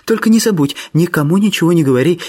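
put-on high young lady's voice, urging; grateful, secretive Miss Sophia. Miss Sophia begs George not to betray her, high urging voice. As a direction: urge secrecy in a lifted feminine register.

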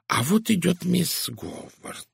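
sudden noticing. A narrator's reading. Detective spotting the woman he needs, sudden noticing. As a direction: break off as someone comes into view. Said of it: "внезапно сказал Пуаро".

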